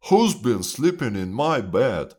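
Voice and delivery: loud father bear at normal pitch; storybook papa bear booming the question. A narrator's reading. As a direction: demand it loudly as father bear. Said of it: "says Father Bear".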